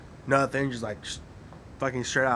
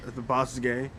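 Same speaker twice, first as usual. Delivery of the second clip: ordinary talk, nothing marked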